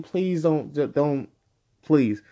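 ordinary talk, nothing marked

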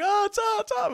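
very high mock cheering; hyped-up buddies. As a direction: imitate friends egging someone on. Buddies reacting in group chat.

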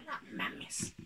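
a soft, breathless whisper; whispered no mames to himself. Retold or imitated. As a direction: mutter it so nobody hears. Retold reaction to rude lady.

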